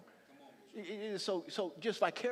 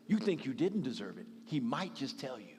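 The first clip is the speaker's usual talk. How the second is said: ordinary talk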